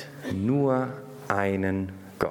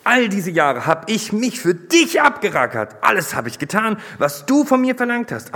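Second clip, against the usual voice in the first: put-on loud, high, bitter outburst of the older son; 5 semitones higher, 6 dB louder than usual. A narrator's reading. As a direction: hurl the years of grievance at the father. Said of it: "Doch er entgegnete ihm bitter". (bitter, laut und hoch, der ältere Sohn klagt den Vater an)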